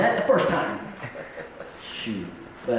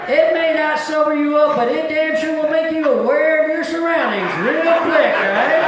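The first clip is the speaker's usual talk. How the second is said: punchline shout; 10 semitones higher, 6 dB louder than usual. Real, not acted.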